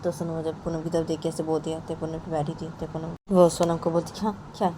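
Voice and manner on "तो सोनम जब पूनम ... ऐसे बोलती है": usual speaking voice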